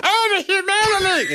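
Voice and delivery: squeaky very high helium-voice yell. Pretend. Helium worker warning everyone to run, squeaky helium voice. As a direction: squeal it like you just inhaled helium.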